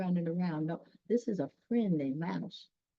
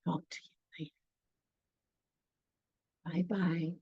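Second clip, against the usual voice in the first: whispered goodbye; 7 dB quieter, much faster than usual. Real, not acted.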